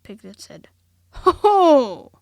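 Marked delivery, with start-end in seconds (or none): 1.1-2.1 very loud high would-be fierce Ho, ho!; a small animal trying to sound fierce. A narrator's reading. Piglet bluffing the Heffalump, loud would-be fierce Ho, ho. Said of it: "as fiercely as he could".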